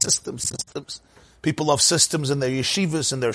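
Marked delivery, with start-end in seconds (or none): 0.0-1.0 whispered, much softer echo of the word; whispered repeat. Acted. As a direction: breathe the words out like a mocking echo. Mocking love of systems.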